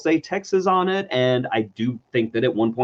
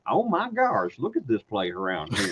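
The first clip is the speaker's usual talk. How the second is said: alien prince gawking with a Texas twang; Texas accent; 10 semitones higher than usual. Retold or imitated. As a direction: play it as a wide-eyed Texan. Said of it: "this alien prince has a Texas accent".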